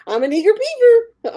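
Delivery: high, squeaky mock enthusiasm; imitated eager blurt. Friend teases the other's awkward line, high blurt.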